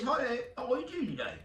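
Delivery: high-pitched woman's voice, put on; imitated new hire. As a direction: mimic her greeting in a high voice. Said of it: "And she says".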